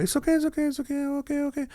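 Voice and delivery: very high, soft women's reassurance; mock soothing. Man imitating two women tending to him, high soothing voice. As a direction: coo it softly in falsetto, over and over.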